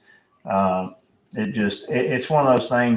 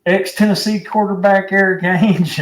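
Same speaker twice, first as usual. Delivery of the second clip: higher, louder mock caller on the radio; old caller voice; 9 semitones higher, 5 dB louder than usual. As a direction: put on a fired-up old caller's voice. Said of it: "he goes".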